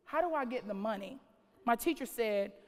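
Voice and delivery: ordinary talk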